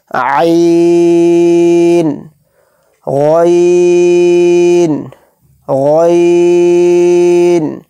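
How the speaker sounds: each letter name drawn out long and chanted; slow tajweed-style letter chant; stretched recitation. Shown as a demonstration. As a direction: hold the vowel for three alif counts. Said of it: "اس کو تین الف کی مقدار کھینچ کر پڑھیں گے".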